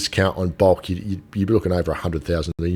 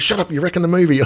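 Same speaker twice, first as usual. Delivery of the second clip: wife snapping; 10 semitones higher, faster than usual. Retold or imitated. Wife hushing husband at the movies, high and mock annoyed. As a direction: imitate the wife telling him off. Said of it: "She goes".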